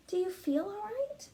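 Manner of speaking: worried mother. Acted. Mother feeling her child's forehead, gently worried. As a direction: ask like a worried mom. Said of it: "she asked".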